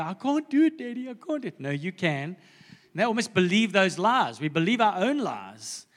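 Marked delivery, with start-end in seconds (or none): 0.0-1.6 put-on whining child. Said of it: "and they go".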